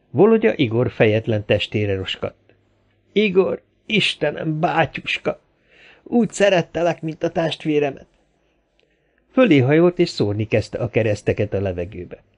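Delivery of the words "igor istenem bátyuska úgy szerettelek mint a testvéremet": high-pitched mourning cry; grieving lament. A narrator's reading. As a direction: mourn a brother aloud. Grieving over dead friend's body. (magas hangú, gyászos siratás)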